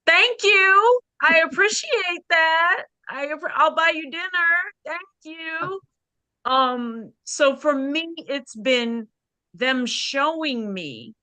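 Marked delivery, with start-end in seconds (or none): 0.1-5.8 gushing thanks. Retold or imitated. To friend's husband offering help, gushing thanks. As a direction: gush with delight. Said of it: "And I'm like".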